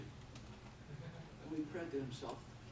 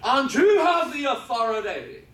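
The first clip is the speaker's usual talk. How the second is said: loud, high-pitched preacher's cry; preaching at full force; 13 semitones higher, 16 dB louder, slower than usual. Real, not acted. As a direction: proclaim it at full volume to the congregation.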